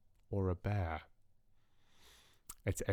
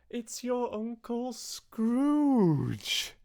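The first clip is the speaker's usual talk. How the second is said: very high and slow, drawn out; high, slow gleeful reveal; triumphant reveal; much slower than usual. Acted. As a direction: savour the punchline. Sister reveals the answer triumphantly.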